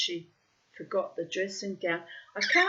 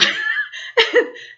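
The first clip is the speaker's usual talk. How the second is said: a big high-pitched laugh out loud; high burst of laughter; 10 dB louder than usual. Real, not acted. Laughing at her own story.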